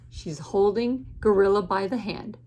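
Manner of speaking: ordinary delivery, nothing marked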